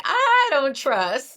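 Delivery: high, loud and slow, playing the wary guest; host hams up a suspicious Erica; mock suspicious voice. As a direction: drag out the distrust in a put-on high voice. Host mock-voicing guest's distrust.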